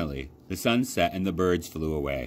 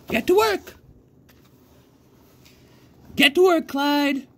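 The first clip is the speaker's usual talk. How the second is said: put-on high, loud old lady command; old woman barking orders; 6 dB louder than usual. A narrator's reading. Old lady orders her scarecrow to get to work, high and loud. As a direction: boss him like a stubborn old farm woman. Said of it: "she said".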